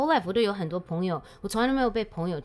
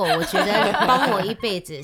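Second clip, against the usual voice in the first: usual speaking voice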